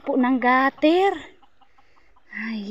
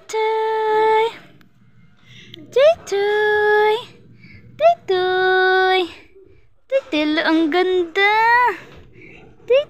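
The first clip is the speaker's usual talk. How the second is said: high drawn-out greeting for a pet; high-pitched pet talk; cooing to dog; 6 semitones higher, 3 dB louder, much slower than usual. Real, not acted. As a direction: coo and call to a cute little dog, stretching each word. Calling to a cute dog.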